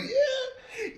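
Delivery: high, light mock yeah; nonchalant golfer. Host imitating the golfing president, high and nonchalant. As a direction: toss it off in a high voice. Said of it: "he was just like".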